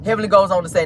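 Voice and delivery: usual speaking voice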